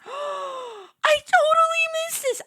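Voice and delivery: high, loud squealing excitement; so excited. Real, not acted. Streamer discovering a new plushie, squealing with excitement. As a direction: squeal it out with real joy. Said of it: "I'm so excited".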